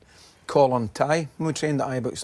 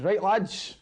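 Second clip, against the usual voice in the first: higher-pitched mock call to the room; retold shout; 5 semitones higher than usual. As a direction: call the group to attention, half-shouting. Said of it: "and just shouted".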